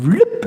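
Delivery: quick high vlip of being sucked in; suction noise. Retold or imitated. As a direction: make the slurp of a vacuum cleaner. Imitating vacuum suction.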